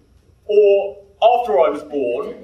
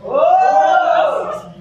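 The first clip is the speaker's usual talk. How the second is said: loud, high startled exclamation; surprised whoa; 8 semitones higher, 6 dB louder than usual. Real, not acted. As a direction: cry out in delight. Host reacts to rule break.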